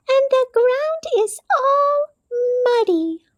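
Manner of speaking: high, small mouse voice; little Mouse voice. A narrator's reading. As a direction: speak as a tiny gentle mouse. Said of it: "said Mouse".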